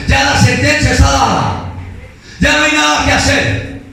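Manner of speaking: high, declaiming sermon voice; fervent preaching. Acted. Preacher reading God's judgement on Eli's house, fervent. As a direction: proclaim the verdict high and drawn out.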